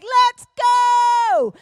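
high, full-volume rallying shout; hammed-up battle cry, stretched out; fired up. Teenager back from festival, wildly fired-up shout. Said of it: "I'd be so fired up".

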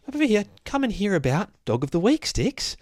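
high-pitched pet-calling voice; calling a dog. Real, not acted. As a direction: coax the dog over, high and light.

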